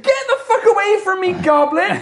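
high-pitched acted outburst; high, hammed-up rage; angry farmer. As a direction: drive the goblin off, squawking. Farmer drives the goblin away.

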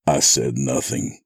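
usual speaking voice

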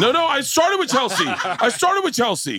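high laughing protest. Real, not acted. Laughing protest over others.

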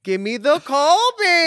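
high, slow shouted command; photographer yelling. Retold or imitated. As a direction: call out the pose across the studio. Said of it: "a Videla yells at you".

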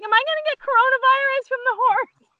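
very high, mock-hysterical worry; retold squeal of alarm; panicked question. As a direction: wail it way up high.

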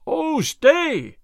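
high, loud, far-carrying call; shouting after someone. A narrator's reading. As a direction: shout to halt a fleeing man. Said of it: "shouted Will Stuteley after the sheriff".

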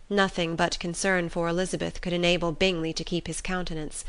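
ordinary delivery, nothing marked

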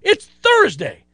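real exasperation, slow on Thursday; still pissed. Real, not acted. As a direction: let the lingering anger crack the pitch up high. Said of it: "I'm still pissed".

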